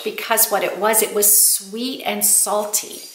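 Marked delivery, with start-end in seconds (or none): none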